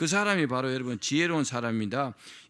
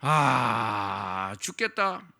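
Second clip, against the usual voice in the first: mock sigh; 4 semitones lower, 4 dB louder, much slower than usual. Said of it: "뭐라고 말해야 돼요".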